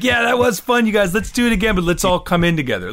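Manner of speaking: imitating forced calm, pitched high; pretending to be calm. Kid bandleader coaxing friends through another take, pretending to be calm. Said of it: "you can hear me pretending to be calm".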